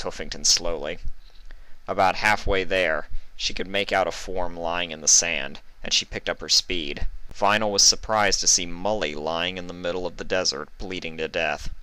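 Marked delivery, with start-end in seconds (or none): none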